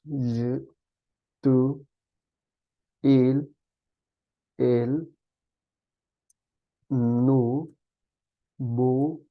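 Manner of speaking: ordinary talk, nothing marked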